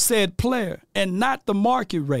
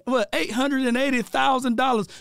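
rising, raised, sing-song preacher voice; preaching; 4 semitones higher than usual. Acted. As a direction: preach it like a Sunday sermon. Preaches money sermon over music.

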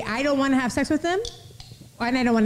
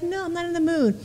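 higher, lightly voiced refusal; mock reluctant girl; 6 semitones higher, 3 dB quieter than usual. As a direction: lightly play a girl saying no. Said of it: "Most girls are like".

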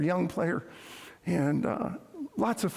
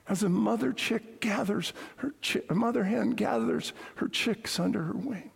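lament fading to whisper; 2 semitones higher than usual. Acted. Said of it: "and he cries out".

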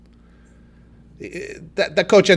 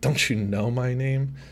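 lightly voiced smug coach, dropping lower; a hint of an arrogant coach at the mic; 3 semitones lower, 5 dB quieter than usual. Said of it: "he is a bit smug at the mic".

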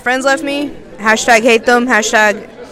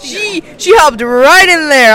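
surprised; 3 semitones higher, 9 dB louder, much slower than usual. Real, not acted. Surprised by a jump-in answer.

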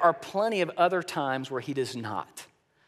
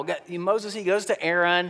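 usual speaking voice

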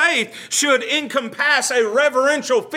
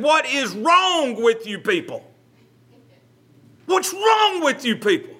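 riled up; 4 semitones higher than usual. Retold or imitated. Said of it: "got really riled up".

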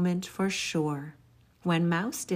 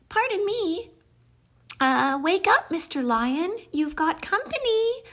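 high, squeaky, nervous mouse; a tiny mouse daring to ask; high little mouse voice, nervous and polite; timid mouse; 10 semitones higher than usual. Acted. Mouse asks lion.

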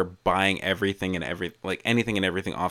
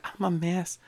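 small high, soft voice; mock self-pity; 8 semitones higher, 9 dB quieter than usual. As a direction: squeak it softly, mimicking your friend. Mimicking the friend's self-mockery.

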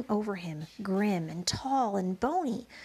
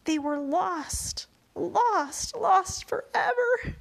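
despairing, near tears; 7 semitones higher, 4 dB louder, slower than usual. A narrator's reading. James losing his treasure, rising into a sob. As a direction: let the repeated word break into crying. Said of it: "James felt like crying".

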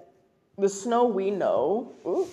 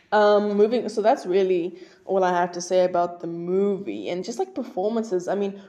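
ordinary talk, nothing marked

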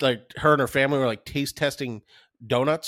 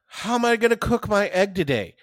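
higher-pitched mock cooking-show host; mocking imitation; 7 semitones higher than usual. Podcaster mocks an egg video, sing-song mockery. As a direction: parody a video host pondering breakfast.

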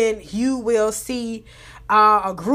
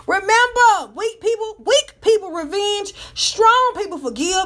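higher, upbeat closing sayings; motivational sign-off; 7 semitones higher than usual. Acted. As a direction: preach the closing quotes. Sign-off quotes.

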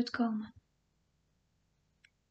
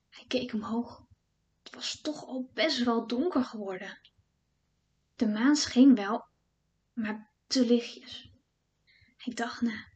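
hushed storytelling; 3 dB quieter than usual. A narrator's reading. Spannende vertelling in het bos.

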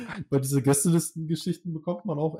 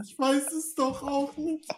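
high, slow, whiny lost voice; helpless guest; 11 semitones higher, slower than usual. Retold or imitated. Guest at the cash desk who forgot his own name, whiny. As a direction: play the clueless guest pitifully.

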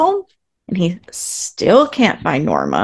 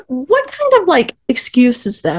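incredulous; 7 semitones higher than usual. Retold or imitated. Incredulous at Norman's excuse.

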